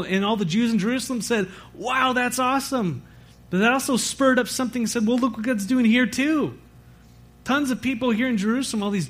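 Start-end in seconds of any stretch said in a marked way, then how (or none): 1.7-3.0 high-pitched imitation of an impressed crowd; retold amazement. Acted delighted crowd reaction. 5.1-6.6 lightly acted eager reply, pitched up; upbeat quoted enthusiasm; retold excitement.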